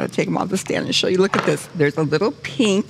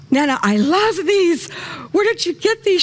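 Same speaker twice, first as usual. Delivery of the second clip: excited delight; 12 semitones higher than usual. Retold or imitated. Gasps, delighted quoting herself.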